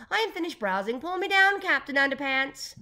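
high, loud comic command; calling out. A narrator's reading. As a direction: shout the order from up on the ladder. Boss orders ladder to descend.

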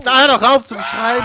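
agitated outburst over the noise; exasperated shout. Real, not acted. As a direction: yell at them to cut it out. Snaps at screaming game character.

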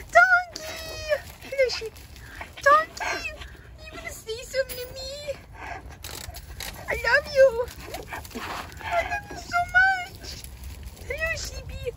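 high squealing pet talk. Real, not acted. Cooing at donkey and sheep.

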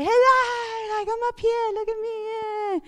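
high, sing-song call from above; playful high hello shouted down to the campsite; calling down the cliff. Retold or imitated. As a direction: call down to your husband below the cliff. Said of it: "shout down to Dwight and say".

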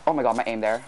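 ordinary talk

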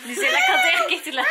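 high squealing laughter; shrieking laughter. Real, not acted. Mother tickling kids, shrieking laugh.

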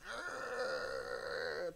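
hushed demon growl; evil demon. Retold or imitated. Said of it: "They go from evil demon".

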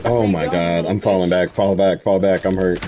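loud urgent call to fall back; frantic retreat order. Real, not acted. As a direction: yell at the squad to pull back. Under fire, calling retreat.

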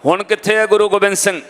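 ordinary talk

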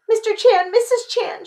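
high, loud, flustered call; alarmed storybook girl; startled cry. A narrator's reading. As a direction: cry out on being caught. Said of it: "she cried".

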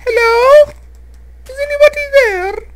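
eerie falsetto creepy caller; creepy falsetto YouTuber bit; Simon from Yogscast. Retold or imitated. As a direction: mimic a ghostly falsetto plea. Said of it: "Simon from Yogscast? ... the one that goes".

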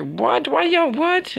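high, incredulous squeal; shocked disbelief, voice jumping way up; surprised. Real, not acted. Gamer shocked the guards want him dead, high incredulous cry. Game NPCs suddenly turn on him.

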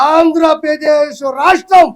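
loud, slow, hammering out the state's name; a slow indignant outburst; emphatic shout. Real, not acted. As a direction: shout it at the cameras. Angry political accusation at press.

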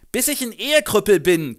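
laut und höher, spöttisch-wütend. Acted. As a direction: fauch ihn an, er spielt sich auf. Reinhold wütend auf Franz. (Reinhold wütend zu Franz, laut und höher)

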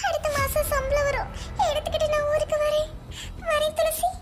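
high-pitched tearful pleading; crying. Acted. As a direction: sob the words out to a close friend. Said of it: "ஏன் அழுகுறா?". (அழுதுகொண்டே)

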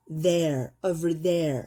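there said slowly with voiced th; slow demo. Shown as a demonstration. Teacher modelling there for students, slowly. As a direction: drag out there, buzzing the th. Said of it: "할 때 the".

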